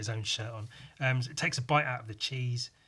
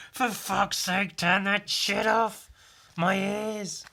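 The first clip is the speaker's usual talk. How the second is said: higher, louder, slow furious groan; pained angry shout; 7 semitones higher, 5 dB louder, slower than usual. Acted. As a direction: roar in pain at the music. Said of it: "him going".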